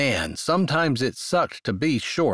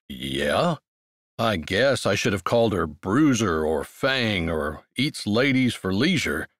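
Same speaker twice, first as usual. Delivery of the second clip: lightly lowered, amused male voice; low man's voice; 4 semitones lower than usual. Acted.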